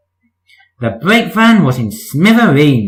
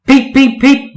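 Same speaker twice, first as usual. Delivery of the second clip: high, louder peep like a train whistle; high piping whistle peeps; whistle call; 11 semitones higher, 4 dB louder than usual. Acted. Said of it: "whistled Percy".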